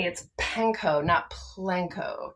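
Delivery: "panko" and "Plenko" drawn out slowly; stress on panko. Shown as a demonstration. Correcting the co-host's word slowly and pointedly. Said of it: "if we're gonna be curmudgeon-y".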